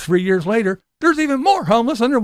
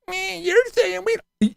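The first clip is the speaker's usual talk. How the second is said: high slow thin whine; whiny mimic; 9 semitones higher, 3 dB quieter, slower than usual. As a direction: drag it out whining. Mocking liberal reply.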